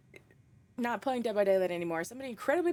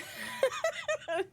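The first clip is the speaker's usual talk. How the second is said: very high airy laugh; squeaky breathy laugh; high giggle. Real, not acted. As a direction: giggle at her own defeat. Laughs about losing.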